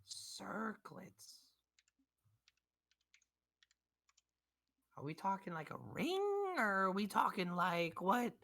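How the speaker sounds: put-on high, loud grumpy Southern old man; cranky old shopkeeper. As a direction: bark at the adventurers like a fed-up old codger. Shopkeeper questions the customer.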